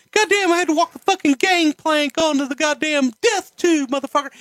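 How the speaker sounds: high, strained, cursing rant in a put-on voice; high-pitched ranting put-on voice; Jim Cornette impression. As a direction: do him as a shrill, outraged Southern manager swearing a blue streak. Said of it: "he goes".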